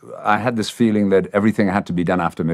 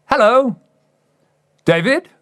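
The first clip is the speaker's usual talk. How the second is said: manager's greeting; 3 dB louder than usual. Retold or imitated. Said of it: "And he goes".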